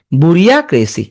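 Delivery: "বুরিয়াক্রেসি" with a full "বুরিয়া" before "ক্রেসি"; memory-aid pronunciation. Shown as a demonstration. Teacher telling students to say it this way, spelling-style. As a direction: lean on "বুরিয়া", then add "ক্রেসি". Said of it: "এমনি উচ্চারণ করবে".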